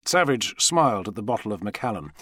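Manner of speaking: plain reading voice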